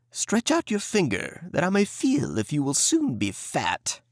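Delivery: high, wheedling witch voice; creepy witch. Acted. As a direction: coax the boy to the bars. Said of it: "crept to the little stable and cried".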